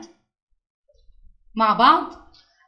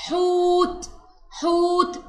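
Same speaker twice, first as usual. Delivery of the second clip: "حوت" with a high, long "oo"; long oo vowel; 5 semitones higher, much slower than usual. Shown as a demonstration. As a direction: hold the middle of "حوت". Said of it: "هنا مد بالواو". (مدّ الواو في "حوت")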